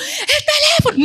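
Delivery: de golpe, muy aguda y riendo, ¡el teléfono!; pánico fingido. Retold or imitated. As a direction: imita el susto de perder el móvil entre risas. Imita pánico al perder teléfono. (susto exagerado y cómico)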